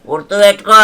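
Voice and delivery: put-on loud drunken rant at his wife; angry rant. Drunk husband accusing his wife of plotting, loud rant. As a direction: bellow the accusations.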